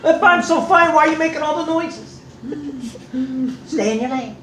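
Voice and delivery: husband fed up with wife's gasps; exasperated. Retold or imitated. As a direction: argue back, fed up. Retold quarrel with wife.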